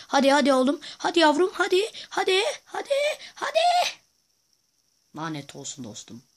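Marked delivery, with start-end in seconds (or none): 0.1-3.6 rising excited shout. Real, not acted. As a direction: cheer rising ever higher and louder. Cheering on the attack. (heyecanla yükselen bağırış)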